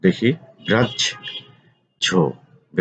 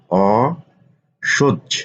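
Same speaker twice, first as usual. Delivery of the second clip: অসহ্য said very slowly, sounds held; drawn-out spelling; much slower than usual. Shown as a demonstration. Teacher to students, slowly stretching অসহ্য. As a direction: linger on অসহ্য. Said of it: "teacher stretches word for students".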